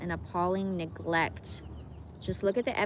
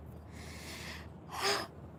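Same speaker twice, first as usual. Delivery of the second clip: 1 sobbing gasp; 12 semitones higher, 10 dB quieter than usual. Real, not acted. Crying while reading aloud.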